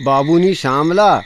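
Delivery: ordinary delivery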